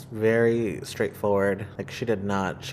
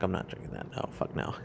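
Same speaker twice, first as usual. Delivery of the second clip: whispered refusal; 3 semitones lower, 13 dB quieter than usual. Retold or imitated. Refusing medicine.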